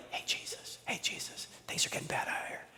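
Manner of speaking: hushed, polite whisper to a sleeping man; timid whisper. Retold or imitated. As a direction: disciples tiptoeing awake their teacher, whispering. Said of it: "No, they were screaming".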